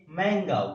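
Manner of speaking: मैंगो said with a clear open ending vowel, as मैंगा; correct mango. Shown as a demonstration. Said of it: "सही है".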